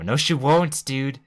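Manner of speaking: emphatic contradiction. Real, not acted. Player objecting to a character's reassurance, loud and emphatic. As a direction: burst in loudly to disagree.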